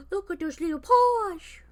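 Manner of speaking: delighted gasp. Real, not acted. Cooing at cat.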